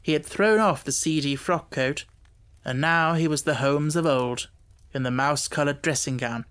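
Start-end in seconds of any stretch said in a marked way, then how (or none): none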